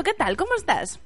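usual speaking voice

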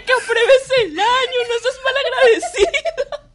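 high, loud, laughing lecture, slowing at the end; mock scolding mother. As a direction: ham up a mother guilt-tripping. Friend voices scolding mother.

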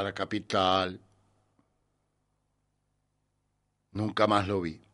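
deliberate phrases with long, heavy pauses; dramatic monologue reading. Acted. La gringa loca telling of her killed son, dramatic monologue. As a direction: perform a bitter mother's monologue, line by line.

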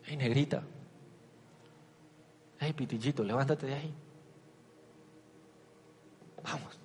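soft, tender fatherly coaxing; hushed loving dad voice; father to daughter. Retold or imitated. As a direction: coax your little girl out of bed. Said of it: "Como yo le hablaría a mi hija".

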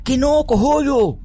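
loud, slow exasperated no; irritated insistence. Acted. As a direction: shut down the idea firmly. Father insists forcefully.

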